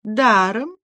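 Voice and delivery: languid one-word question, rising; lazy question. A narrator's reading. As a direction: ask it lazily. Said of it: "лениво спросила Аглая". (ленивый вопрос)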